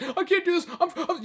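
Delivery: high-pitched mock panic; freaking out. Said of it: "a guy who's just freaking out".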